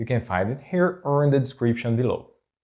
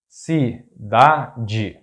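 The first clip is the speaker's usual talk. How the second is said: cidade split, ci - da - de; syllable by syllable. Shown as a demonstration. Teacher breaking down an example word, cidade in separate syllables.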